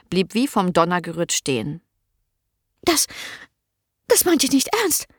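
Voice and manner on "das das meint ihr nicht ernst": hohe, fassungslose Stimme; schockierte Magd. A narrator's reading. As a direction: entsetzt und stockend, hoch gesprochen. Merle shocked, high. (Merle reagiert entsetzt auf Annas Geständnis)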